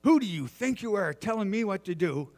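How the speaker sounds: high-pitched, indignant mock outburst; retold anger. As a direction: imitate an offended man challenging you. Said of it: "He come over to me just as mad".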